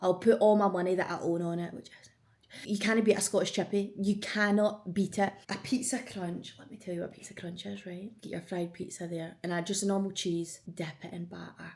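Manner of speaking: ordinary talk, nothing marked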